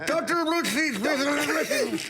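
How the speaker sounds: high-pitched mock speech impediment; hammed-up impression of a stuttering man pleading; imitating his speech. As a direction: beg in a high, comic put-on voice. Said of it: "he talks like this".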